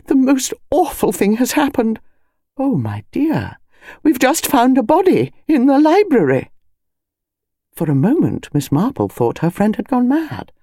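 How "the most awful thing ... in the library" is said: high-pitched, agitated burst of news; breathless and agitated. A narrator's reading. Mrs Bantry telling her friend of a body, breathless and agitated. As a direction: blurt out shocking news, breathlessly. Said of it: "voice came breathless and agitated".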